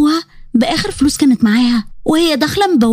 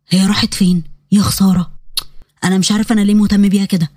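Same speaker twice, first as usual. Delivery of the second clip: put-on lower, easygoing young man brushing off an apology; Yazan's calm male voice; 7 semitones lower than usual. Yazan apologizing to Huda after bumping her, low and easy. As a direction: drop into a low relaxed male voice.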